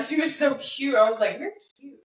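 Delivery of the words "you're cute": higher, much softer coo; tender coo. Retold or imitated. Softly cooing to the puppy held like a baby. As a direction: coo it to a puppy in your arms.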